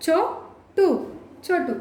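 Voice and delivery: short "చొ" in "చోటు", first split, then quick; short vowel. Shown as a demonstration. Teacher showing what the wrong spelling gives, short first vowel. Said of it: "ఇలా రాస్తే ఏమవుతుంది".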